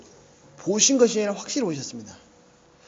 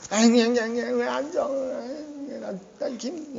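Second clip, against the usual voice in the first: 앵앵대는 아이 흉내; 4 semitones higher, much slower than usual. Retold or imitated. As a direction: whine wordlessly like a fussing child. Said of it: "앵앵앵 거리니까". (높은 소리로 앵앵 우는소리 흉내)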